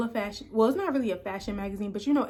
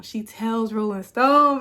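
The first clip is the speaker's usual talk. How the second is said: slow, loud and proud; proud emphasis; 2 semitones higher, 10 dB louder, slower than usual. Real, not acted. Proud vlogger repeating her press quote, slow and loud. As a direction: savor each word, louder.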